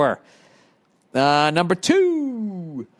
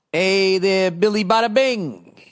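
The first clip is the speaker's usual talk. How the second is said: higher-pitched, showy salutation; hammed-up greeting; 4 semitones higher, slower than usual. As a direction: call out the name with a lightly put-on flourish. Reading out a listener's greeting.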